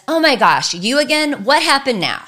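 loud, high, fed-up outburst; overwhelmed mom loudly exasperated at her crying child. Retold or imitated. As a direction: snap at the kid in frustration. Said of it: "she says".

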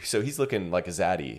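usual speaking voice

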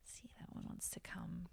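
hushed whisper. Real, not acted. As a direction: breathe it out under the breath. Playfully whispering while drawing a card.

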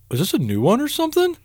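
high-pitched mocking question; mock-innocent, high voice; imitating clueless concertgoer. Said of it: "I hear him go behind me".

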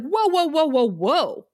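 high, punchy string of protests; mock alarm. Host reacting to quesadillas at the Bronze, mock alarm. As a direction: halt everything in exaggerated disbelief. Acts out own reaction to menu.

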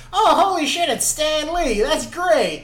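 acted burst of happy recognition; mock delighted. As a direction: exclaim it like a thrilled moviegoer. Imagined delighted reaction to cameo.

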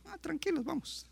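Jesus gently calming the worried crowd. Retold or imitated. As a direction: soothe them, no hurry at all. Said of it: "cuando terminó le dijeron".

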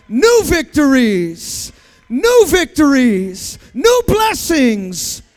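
very loud, drawn-out preacher shout; booming proclamation. Real, not acted. Preacher proclaiming new victories to congregation, booming proclamation. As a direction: belt out NEW VICTORIES slowly.

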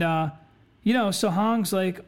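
ordinary talk, nothing marked

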